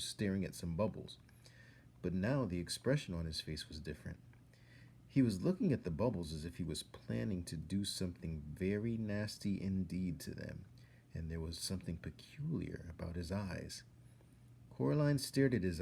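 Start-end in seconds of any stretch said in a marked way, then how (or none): none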